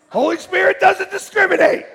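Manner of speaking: said high and loud through a laugh; a loud, high, laughing punchline. Real, not acted. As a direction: laugh it out to the crowd. Laughing line to congregation.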